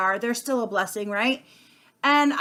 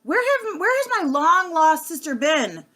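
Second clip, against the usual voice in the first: high, louder, slow squeal of delight; excited girlfriend greeting, pitched way up; gushing girlfriend; 9 semitones higher, 5 dB louder than usual. Retold or imitated. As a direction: imitate a friend overjoyed to see you, high and drawn out. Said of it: "their girlfriend is like".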